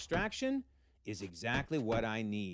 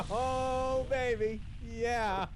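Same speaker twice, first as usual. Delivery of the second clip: thrilled; 10 semitones higher, much slower than usual. Real, not acted. Vlogger cheering as MJ tells Anna to shut up. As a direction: cheer like your team just scored.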